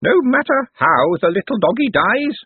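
put-on high, slow little old-lady voice; elderly aunt. A narrator's reading. Aunt Careful considers the dog's death, high elderly voice. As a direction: muse slowly in a high elderly voice. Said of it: "said an elderly voice".

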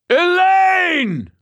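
booming high shout; thundering. A narrator's reading. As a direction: thunder her name. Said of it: "I thundered".

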